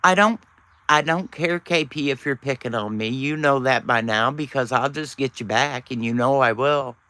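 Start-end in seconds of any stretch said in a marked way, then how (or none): none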